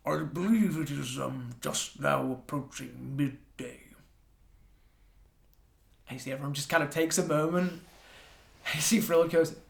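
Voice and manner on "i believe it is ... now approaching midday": slow, hushed odd voice; Offanim's voice. Acted. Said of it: "Offanim steps forward and just goes".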